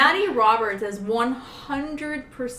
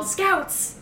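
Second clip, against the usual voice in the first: high-pitched pretend call-out; playful high mock yell; mock cop yell; 9 semitones higher than usual. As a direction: imitate a cop yelling, without real volume. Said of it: "did the cops yell".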